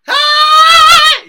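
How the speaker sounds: very high, very loud shout; full-power yell; high shout demo. Shown as a demonstration. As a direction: hit the top of your range. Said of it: "you gotta learn to get the range".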